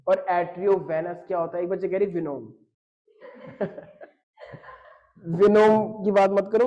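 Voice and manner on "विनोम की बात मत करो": laughs through the words; amused, laughing reply. Real, not acted. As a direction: speak while laughing at the joke. Laughing at the Venom answer.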